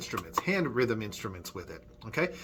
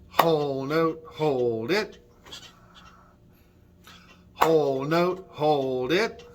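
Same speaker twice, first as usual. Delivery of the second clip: rhythm call; 4 dB louder, slower than usual. Shown as a demonstration. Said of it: "clap a few rhythms with me".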